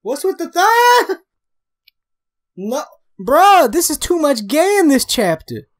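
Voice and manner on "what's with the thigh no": ordinary delivery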